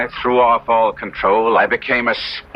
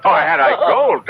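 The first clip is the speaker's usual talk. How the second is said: much higher and louder, anguished; despairing cry; 4 dB louder than usual. Acted. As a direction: wail it in despair. Despairing outburst about poverty.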